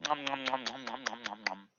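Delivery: soft fading nom nom; munch softly like a puppet eating; eating noises. Pretend.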